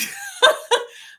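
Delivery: real laugh breaking out high; high burst of laughter. Real, not acted. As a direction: laugh at your own joke. Laughs at her own blah blah.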